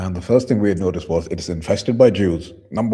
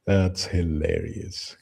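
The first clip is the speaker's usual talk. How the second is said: usual speaking voice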